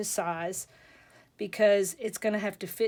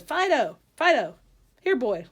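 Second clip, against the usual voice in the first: loud, higher call to a pet; punchy, raised voice with falling calls; calling the dog; 4 semitones higher, 6 dB louder than usual. Acted. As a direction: act out summoning a dog to perform. Joke owner calling his dog.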